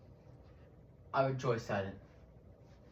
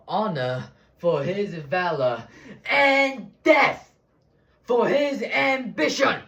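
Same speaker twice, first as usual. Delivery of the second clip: building declamation; 10 semitones higher than usual. Acted. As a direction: swell from measured tribute to thundering verdict. Acted speech building to a shout.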